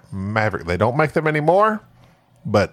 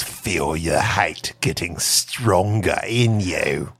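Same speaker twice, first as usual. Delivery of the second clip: Palpatine impression; 8 semitones lower, slower than usual. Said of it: "Emperor Palpatine".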